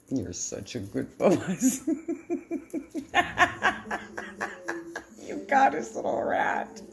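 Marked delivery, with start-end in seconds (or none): 1.9-5.0 airy, voiceless giggle; breathless laugh. Real, not acted. Owner watching her dog and cat play, airy giggle. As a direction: laugh under the breath at the animals.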